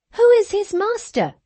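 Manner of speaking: put-on high, pressing girlish demand; tiresome girl. A narrator's reading. Cathy badgers Ellen about Hareton, high insistent girl. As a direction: needle him like a spoilt child. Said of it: "continued the tiresome girl".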